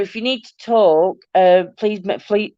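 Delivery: usual speaking voice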